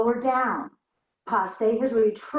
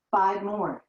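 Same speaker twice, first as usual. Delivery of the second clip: normal talking voice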